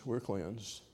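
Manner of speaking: usual speaking voice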